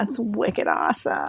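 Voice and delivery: put-on playful Boston accent; light Boston accent, wicked awesome. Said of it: "I'll try to keep my Boston accent under wraps".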